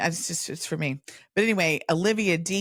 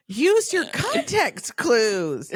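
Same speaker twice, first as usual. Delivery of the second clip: high, slow, drawn-out mock plea; exasperated coaxing; 10 semitones higher, 4 dB louder, much slower than usual. Quizzer urging a friend after a wrong guess, mock exasperation. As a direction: plead slowly with a hopeless guesser.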